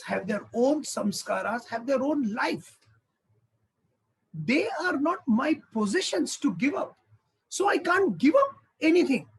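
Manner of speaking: sermon at full force; forceful preaching. Acted. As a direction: drive each point home, climbing in pitch.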